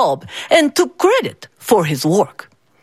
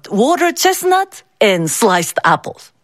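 ordinary delivery, nothing marked

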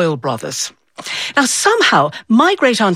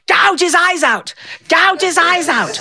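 mock yell; 9 semitones higher than usual. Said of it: "this one was yelling".

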